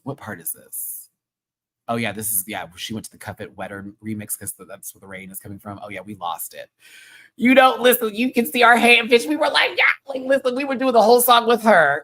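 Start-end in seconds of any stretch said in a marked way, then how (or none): 0.0-1.1 whispered aside; whispering. Real, not acted. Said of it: "whispered aside off-camera". 7.4-12.0 excited. Real, not acted. Excited recap of crowd singing.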